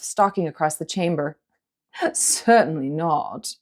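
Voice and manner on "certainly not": amused dragon. A narrator's reading. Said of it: "hearty laugh".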